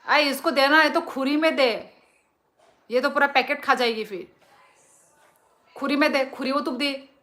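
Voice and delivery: loud, high telling-off across the room; scolding. Real, not acted. Mother scolding family over the snack packet. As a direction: yell at the family to ration the snack.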